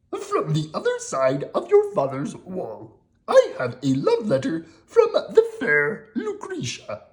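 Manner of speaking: high, eager monster voice; Grover voice. Acted. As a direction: Grover delivering the letter.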